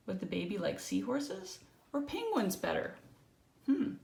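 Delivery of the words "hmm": ordinary delivery, nothing marked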